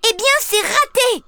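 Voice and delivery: put-on high sarcastic little girl retort; exclaiming. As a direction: snap back at them. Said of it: "s'écrie Fanny". (Fanny, réplique aiguë et piquée)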